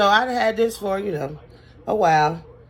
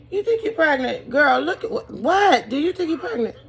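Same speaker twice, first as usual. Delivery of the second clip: incredulous doctor; 8 semitones higher than usual. Retold or imitated. Doctor asking about the pregnancy.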